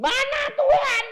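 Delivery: very high, loud mock grumble; grumbling outburst. Preacher mimicking an impatient believer, loud grumbling. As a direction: blurt out an impatient demand for God.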